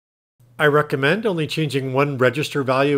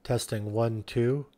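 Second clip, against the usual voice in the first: softly into the walkie-talkie; a hushed mic check; quiet test; 4 semitones lower, 8 dB quieter than usual. Shown as a demonstration. Soft mic check into the walkie-talkie to show the speaker stays off. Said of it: "when you talk loudly".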